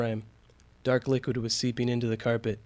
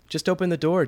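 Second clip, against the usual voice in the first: higher-pitched, urgent exclamation; a mildly raised, urgent voice; exclaiming; 5 semitones higher, 4 dB louder than usual. A narrator's reading. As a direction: exclaim it impatiently from behind someone. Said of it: "Douglas exclaimed from behind Claire".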